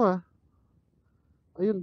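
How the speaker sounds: normal talking voice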